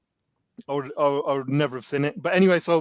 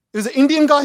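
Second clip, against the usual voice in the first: higher and louder punchline; excited reveal; 9 semitones higher, 5 dB louder, faster than usual. Real, not acted. Revealing to a friend who the voice was, higher and louder. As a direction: land the surprise punchline with glee.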